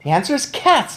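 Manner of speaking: high, loud triumphant call; boy shouts his plan at grandmother; excited shout. A narrator's reading. Triumphant high shout. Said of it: "I shouted".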